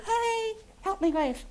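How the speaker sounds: high, soft and slow; small puppet voice. Acted. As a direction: ask sweetly like a little dragon. Grork asks to wave.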